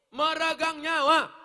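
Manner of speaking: loud, high, impassioned cry; dramatic exclamation. Real, not acted. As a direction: proclaim it forcefully to the congregation. Dramatizes agony of childbirth.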